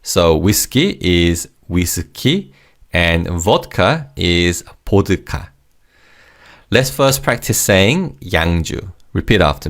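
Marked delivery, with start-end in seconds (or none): 0.4-1.0 "whiskey" in Korean syllables, wi-seu-ki; Korean pronunciation. Shown as a demonstration. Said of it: "are just said phonetically in Korean". 4.9-5.5 "보드카" in three syllables, bo-deu-ka; Korean pronunciation. Shown as a demonstration. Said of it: "are just said phonetically in Korean".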